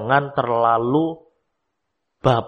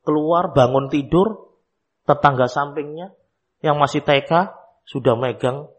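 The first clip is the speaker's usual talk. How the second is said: ordinary delivery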